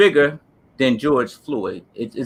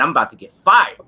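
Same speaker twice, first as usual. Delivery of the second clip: usual speaking voice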